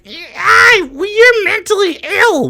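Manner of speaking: high, far-off nerdy sneer; mock troll voice. Mocking chat trolls in a high prissy voice. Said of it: "They're like".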